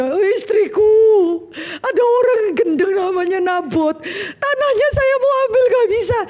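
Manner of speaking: hammed-up crying complaint, voice pushed way up; whining crybaby king. Husband Ahab whines to his wife Isabel about Nabot, mock sobbing, high. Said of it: "Nangis dia".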